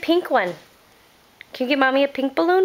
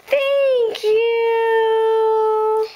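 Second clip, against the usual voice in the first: high, delighted thank you; excited thanks; 9 semitones higher than usual. Real, not acted. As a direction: gasp then thank her brightly. Delighted gasp at gift.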